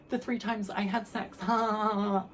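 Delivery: high, light laughing voice; carefree giggly brush-off; breezy laughing. Pretend. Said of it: "I was expecting to just be like".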